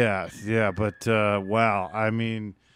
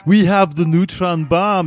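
loud, slow mock Russian villain; hammed-up Russian villain accent; 5 semitones higher, 6 dB louder, slower than usual. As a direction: play the comic Stranger Things Russian. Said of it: "the Russians were my favorite".

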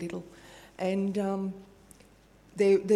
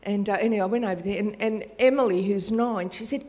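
ordinary talk

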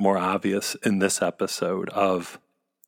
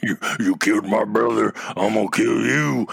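grumpy alien voice. Retold or imitated. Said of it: "the grumpy Quarrens".

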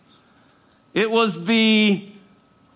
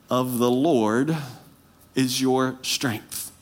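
quiet preaching; 11 semitones lower, 4 dB quieter than usual. Acted. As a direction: lean in and speak it gently to the congregation. Preaching quiet, intimate climax.

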